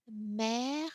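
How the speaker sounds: mer with a loud open "è"; mer, vowel like "bed", drawn out; accent grave contrast. Shown as a demonstration. French teacher comparing E sounds, mer with open è. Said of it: "every time the pronunciation is different".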